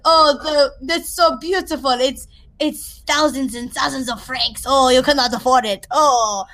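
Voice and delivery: hammed-up French accent with big "Oh"s; theatrical mock-French shopper; bad French accent. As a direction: gush in a cringey fake French accent. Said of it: "this is literally how it sounds".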